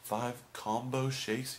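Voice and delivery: puzzled clerk checking an order; confused repeating. Retold or imitated. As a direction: sound out each word, unsure you understood. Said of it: "I kept repeating it 'cause I couldn't understand".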